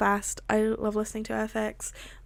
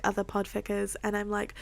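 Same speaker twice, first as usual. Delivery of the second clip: plain reading voice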